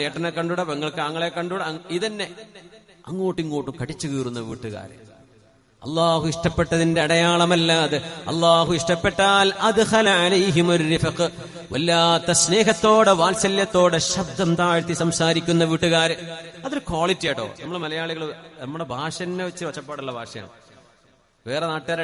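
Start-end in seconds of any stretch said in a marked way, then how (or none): 5.8-16.1 loud, slow, half-sung sermon; preaching. Acted. Preacher telling families which home Allah loves, full-force sermon. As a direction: drive each phrase home, drawn out.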